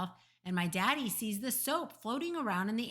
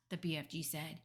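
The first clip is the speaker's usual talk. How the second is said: usual speaking voice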